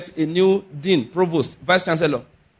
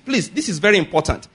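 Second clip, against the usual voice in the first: usual speaking voice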